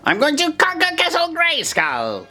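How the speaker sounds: slow, very high villain boast; Skeletor. Shown as a demonstration. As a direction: proclaim it as a theatrical high-pitched villain. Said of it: "Just repeat that".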